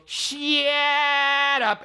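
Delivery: very high, hugely stretched shut up; over-the-top drawn-out mock retort; imitation taken way beyond. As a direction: hold the first word absurdly long. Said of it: "make it go so beyond".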